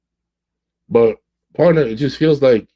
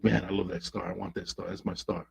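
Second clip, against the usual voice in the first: softer, gazing up in hushed awe; dreamy longing; 3 semitones lower, 13 dB quieter than usual. Retold or imitated. Coach voicing a lovesick man gazing at a star, soft and dreamy. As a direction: breathe it out like a wish. Starry-eyed longing, imagined.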